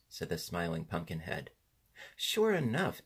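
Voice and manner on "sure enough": high-pitched exclamation of sudden agreement; exclaimed realization. A narrator's reading. Tip notices the horse has no ears, exclaiming. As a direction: exclaim as it dawns on you. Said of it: "exclaimed Tip".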